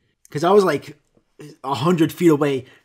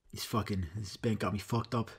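usual speaking voice

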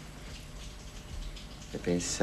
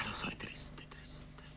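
whispered; 15 dB quieter, slower than usual. Acted. Echoing Erminia's sadness on the phone, in a whisper. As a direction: whisper it, unvoiced and hushed.

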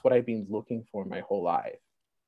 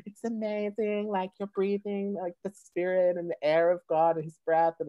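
high, gushing believer's voice; imitated awestruck friend; 9 semitones higher than usual. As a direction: marvel on a high pitch, imitating her. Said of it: "She's like".

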